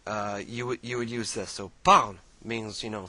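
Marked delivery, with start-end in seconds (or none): 1.8-2.1 loud, sharp order; command. Shown as a demonstration. Teacher demonstrating the imperative, a loud commanding "Parle". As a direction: bark it like an order. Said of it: "this is the commanding tense".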